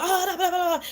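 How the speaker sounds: high, laughing blah blah; hammed-up frantic caller; mock stressed ranting. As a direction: gabble it like a frazzled caller. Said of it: "all stressed out".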